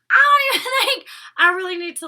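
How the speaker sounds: high, loud burst of laughter through the words; laughing loudly at something outrageous. Real, not acted. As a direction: crack up in disbelief while talking. Laughing at the comment.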